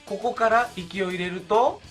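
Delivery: ordinary talk, nothing marked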